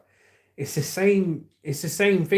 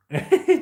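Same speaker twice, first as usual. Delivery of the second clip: laugh; 6 dB louder than usual. Real, not acted. Laughing while reading chat.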